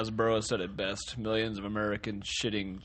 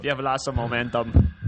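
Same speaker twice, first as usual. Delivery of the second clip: normal talking voice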